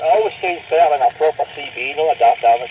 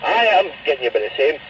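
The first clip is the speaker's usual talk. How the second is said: normal talking voice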